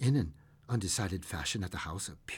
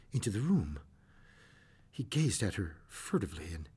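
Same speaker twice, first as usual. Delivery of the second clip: ordinary delivery, nothing marked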